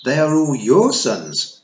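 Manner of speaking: put-on slow, loud aghast girl; stunned, drawing the words out. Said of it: "said Tiffany aghast".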